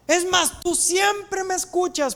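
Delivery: high, ringing prayer, slowing down; cried-out prayer. Acted. Jesus prays aloud to his Father, high ringing call. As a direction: lift the voice high and stretch the words. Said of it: "Jesús grita".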